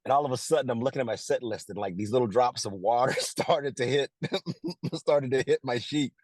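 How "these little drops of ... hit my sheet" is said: higher, amused, laughs breaking the phrase; storyteller cracking up at his own memory; laughing while telling. Real, not acted. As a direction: chuckle mid-sentence and carry on. Laughs telling of tears on page.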